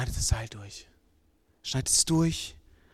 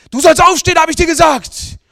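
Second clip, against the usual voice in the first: loud, high furious shout; raging yell; 18 dB louder than usual. Acted. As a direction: scream the order down at him. Father yelling at bound son.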